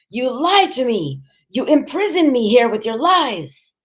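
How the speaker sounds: high-pitched, raging father; furious accusation. A narrator's reading. Enraged father accuses mother in quarrel.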